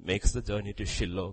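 ordinary talk, nothing marked

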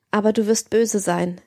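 ordinary delivery, nothing marked

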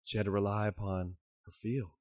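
ordinary delivery

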